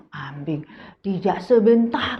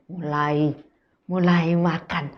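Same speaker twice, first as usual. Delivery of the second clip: ordinary delivery, nothing marked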